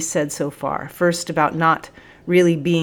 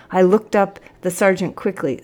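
plain reading voice

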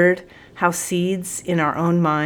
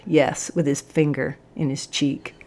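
plain reading voice

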